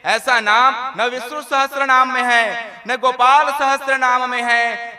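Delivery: loud, indignant protest; astonished Lakshmi. Acted. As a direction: insist no scripture has such a name. (Lakshmi का ऊँचा, हैरान विरोध)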